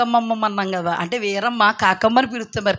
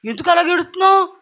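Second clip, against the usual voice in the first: high, louder tearful plea; crying little sister; 8 semitones higher, 3 dB louder than usual. Retold or imitated. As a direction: beg through tears like a young girl. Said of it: "అని ఆ పిల్ల ఏడుపు".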